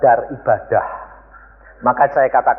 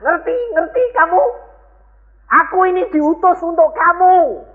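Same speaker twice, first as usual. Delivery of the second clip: stern warning. Retold or imitated. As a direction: warn them sharply. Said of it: "Yesus mau memperingatkan".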